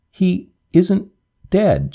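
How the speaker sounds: lightly voiced girl sobbing out a denial; understated tearful protest. A narrator's reading. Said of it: "she sobbed".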